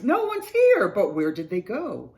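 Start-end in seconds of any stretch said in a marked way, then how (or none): 0.0-0.9 high, loud exclamation; crying out. A narrator's reading. Bear finds nobody in the trees, crying out. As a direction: cry it out in surprise. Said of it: "Bear cries".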